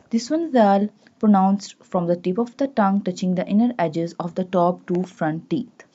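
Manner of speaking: ordinary talk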